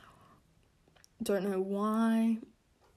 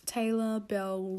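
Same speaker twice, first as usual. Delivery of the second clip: ordinary talk, nothing marked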